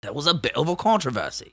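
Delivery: put-on Cockney film-star voice; Michael Caine. Co-host trying another impression for the host, Cockney actor voice. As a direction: lean into a British Cockney impression. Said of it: "What if I do Michael Caine?".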